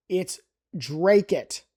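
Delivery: Dracut said slowly as DRAY-cut; slow local Dracut; correct Dracut. Shown as a demonstration. Teaching a viewer the local Dracut, slow and clear. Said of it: "It is not Dracut or Dracut".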